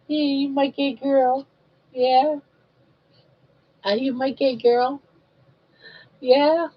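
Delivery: sing-song coaxing, pitch rising toward the end; lightly lilting mama voice; baby talk. Acted. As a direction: coo at the baby to win a smile.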